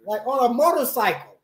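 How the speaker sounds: high, realizing, dawning; mock surprised realization; high surprised self-quote. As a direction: squeak out the realization. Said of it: "I said".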